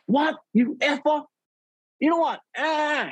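high, sputtering what and insult; outraged boss. Retold or imitated. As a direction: boss sputtering after hearing I quit. Boss reacts to him quitting.

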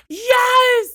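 high, loud triumphant "Yes!"; excited shout. Real, not acted. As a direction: cheer as she finally gets it. She guesses the team.

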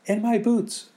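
put-on very high, loud child voice; eager little friend. Friends handing over boots, high eager child.

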